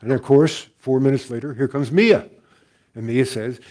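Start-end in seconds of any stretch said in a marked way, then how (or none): none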